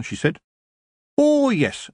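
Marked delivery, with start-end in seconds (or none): 1.2-1.9 high, lifted shopkeeper witch; Mrs Proust, knowing. A narrator's reading. Witch shopkeeper confirms her stock to a customer, knowing. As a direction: pitch it high and pleased, like an old witch.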